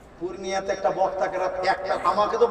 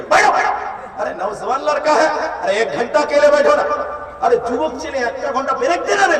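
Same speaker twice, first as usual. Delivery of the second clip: much higher and louder scolding yell; imitating angry preacher; 8 semitones higher, 8 dB louder than usual. Angry preacher yells at a youth not to leave, higher and louder. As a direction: bark at the young man to sit and hold on. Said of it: "বক্তা তখন রেগে গিয়ে বলছে".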